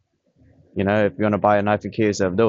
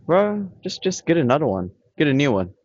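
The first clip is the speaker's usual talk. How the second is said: higher, scoffing bro; dismissive retort; 6 semitones higher than usual. Retold or imitated. Scoffing at the crying boyfriend outside the clinic, higher mock disbelief. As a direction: brush him off, incredulous.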